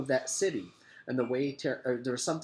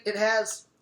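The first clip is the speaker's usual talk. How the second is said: put-on high, loud kid shout; a loud alarmed boy's shout; 10 semitones higher, 6 dB louder than usual. A narrator's reading. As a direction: shout it to everyone. Eric cut off.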